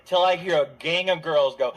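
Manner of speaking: ordinary delivery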